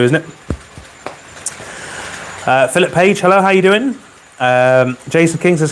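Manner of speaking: ordinary talk, nothing marked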